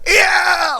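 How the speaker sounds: very high cartoonish scream; imitation of a raging neighbor's yell; mock scream. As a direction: scream it like a man jumping up and down. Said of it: "he starts fucking screaming".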